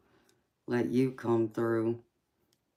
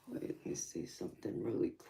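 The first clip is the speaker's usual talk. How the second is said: soft whispered aside, much quieter; 12 dB quieter than usual. Real, not acted. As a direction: whisper it to yourself while checking. Aside while checking something.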